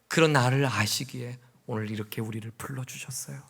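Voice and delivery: quiet, tender awe; hushed reverence. Real, not acted. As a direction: drop to an intimate whisper. Intimate hushed sermon moment.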